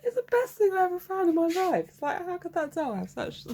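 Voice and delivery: high-pitched, nearly crying with laughter; real laughing fit. Real, not acted. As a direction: squeal it out through helpless laughter. Helpless laughing fit over a doll.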